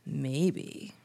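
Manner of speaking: low, slow, hushed menace in a put-on accent; scary old lady killer. Old lady killer plotting to remind them, low menace. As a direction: sink into a soft, deliberate villain's threat. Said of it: "she's a scary old lady killer".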